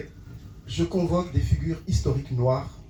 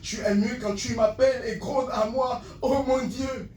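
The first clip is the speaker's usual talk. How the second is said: building slam recital; 4 semitones higher, 4 dB quieter than usual. Acted. Poet declares love for his land, impassioned slam. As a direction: start restrained, drive the poem upward.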